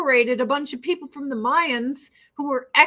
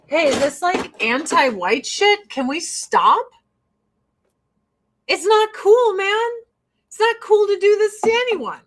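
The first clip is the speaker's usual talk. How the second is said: raised, louder plea to stop; acted protest; 8 semitones higher, 4 dB louder than usual. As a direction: speak up in high-pitched objection.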